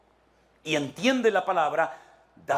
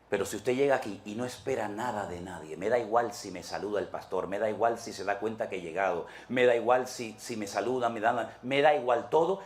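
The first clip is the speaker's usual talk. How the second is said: softer, lightly voiced shrug of a churchgoer; a hint of a carefree churchgoer, softer; unbothered churchgoer; 6 semitones lower, 3 dB quieter than usual. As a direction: toss it off as if nothing matters. Carefree churchgoer who expects nothing.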